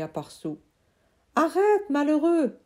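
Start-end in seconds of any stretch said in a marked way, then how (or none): 1.4-2.6 high, loud warning cry; cry of alarm. Acted. Uncle crying out to stop the boy, high and loud. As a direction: yell stop to save the tree. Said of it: "a crié Julius".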